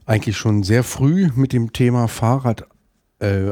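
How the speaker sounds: usual speaking voice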